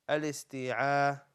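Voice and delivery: "Al-isti'adha" slowly, syllable by syllable, with a long "aa"; slow teaching pronunciation. Shown as a demonstration. Teacher learning it together with pupils, "Al-isti'adha" slow and stretched. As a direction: draw out "Al-isti'adha" for learners to follow. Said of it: "Come on, let's learn it together".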